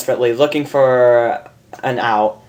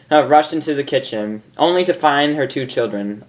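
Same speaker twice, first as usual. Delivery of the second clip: usual speaking voice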